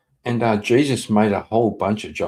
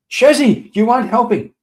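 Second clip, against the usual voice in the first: much higher and louder, scolding at full pitch; exasperated high yell; 12 semitones higher, 8 dB louder than usual. Real, not acted. Streamer yells at chatter Shazzy for making it worse, high exasperated yell. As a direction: cry out in mock despair at her.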